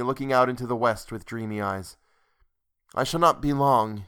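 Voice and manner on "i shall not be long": ordinary delivery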